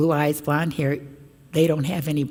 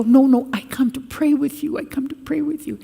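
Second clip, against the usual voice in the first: reassuring; 9 semitones higher than usual. Retold or imitated. Reassuring frightened children.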